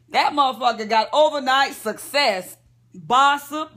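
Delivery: normal talking voice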